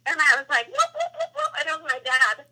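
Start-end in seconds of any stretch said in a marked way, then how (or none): 0.7-1.5 very high whooping calls with a laugh; family whoop call. Retold or imitated. Answering Dad's call in the woods, high laughing whoops. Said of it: "family kinda call, I guess, instead of whistling".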